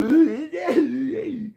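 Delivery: high, hammed-up kid's cry; mock sobbing wail. Imitating himself crying as a kid after a whooping. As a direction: fake a child's wail.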